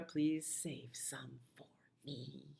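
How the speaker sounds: very slow whispered phrase; whispered song line. Acted.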